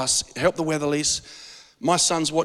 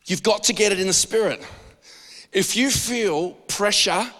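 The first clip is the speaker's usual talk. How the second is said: usual speaking voice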